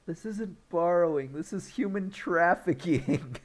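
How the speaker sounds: high-pitched appalled protest, laugh creeping in; mock outrage. Horrified friend objecting to Kevin Bacon gift, mock outrage. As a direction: imitate someone scandalized, voice way up. Retold horrified reaction to a kidnapping.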